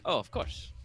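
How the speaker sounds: lightly voiced Italian lord, welcoming; hint of a gracious Italian noble; Italian accent. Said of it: "trying very hard not to become Ezio Auditore".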